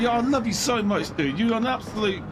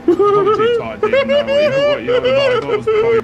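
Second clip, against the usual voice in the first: high and loud; loud explanation; 7 dB louder than usual. Real, not acted. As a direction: talk loudly over the noise. Tired streamer loudly explaining.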